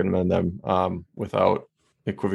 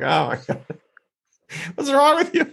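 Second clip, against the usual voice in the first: rising to a high, incredulous squeak; mock exasperation at a wailing dog; exasperated. Owner at the vet, exasperated with her screaming dog. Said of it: "And I'm like".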